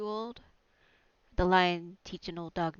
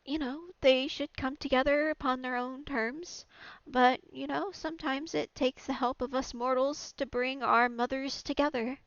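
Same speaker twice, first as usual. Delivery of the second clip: higher, earnest temple priest; 6 semitones higher, 3 dB louder than usual. Acted. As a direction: preach it gently, as the priest. GM voices priest of congregation.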